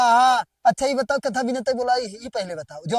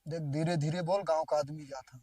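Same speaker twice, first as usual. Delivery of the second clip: low, much softer voice; hushed warning; 9 semitones lower, 15 dB quieter than usual. Acted. Man warning partner that villagers are passing, hushed and low. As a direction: drop your voice so passers-by won't hear.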